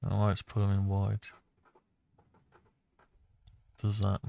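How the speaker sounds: normal talking voice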